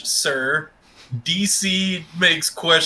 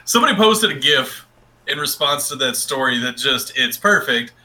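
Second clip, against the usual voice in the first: ordinary talk, nothing marked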